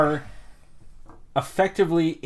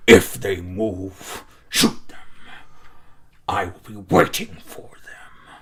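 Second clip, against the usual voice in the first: orc voice; 4 semitones lower than usual. Acted. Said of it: "hear him and Orc go".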